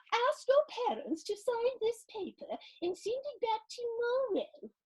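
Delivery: high-pitched lady's voice; playground lady. Acted. Playground lady handing out a speeding ticket, high-pitched.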